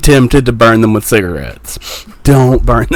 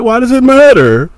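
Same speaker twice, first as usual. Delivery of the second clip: mock doctor; 11 semitones higher than usual. Doctor voiced in retold scene.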